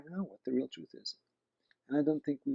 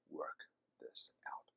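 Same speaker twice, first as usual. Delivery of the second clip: hushed promise; 9 semitones lower, 13 dB quieter, slower than usual. Acted. Jesus' promise closing the quote.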